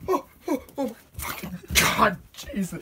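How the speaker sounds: high gasping cries from cold; shocked yelling. Real, not acted. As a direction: cry out at the freezing snow. Shock of snow plunge.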